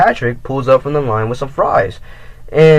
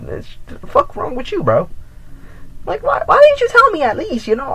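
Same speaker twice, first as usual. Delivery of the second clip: very high, incredulous squeak; high-pitched outraged whine; exasperated disbelief. Real, not acted. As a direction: demand an answer in disbelief. Exasperated rant at ex.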